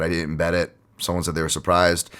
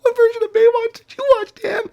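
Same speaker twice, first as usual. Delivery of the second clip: laughing; 8 dB louder than usual. Real, not acted. Co-host cracking up.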